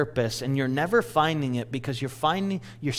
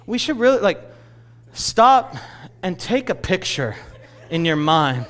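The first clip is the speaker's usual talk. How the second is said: higher, louder plea, acted out; urgent dad; 5 semitones higher, 3 dB louder than usual. Dad stalling his eager son, acted urgent plea. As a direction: retell Dad urging a pause, starting high.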